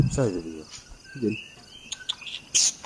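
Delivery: normal talking voice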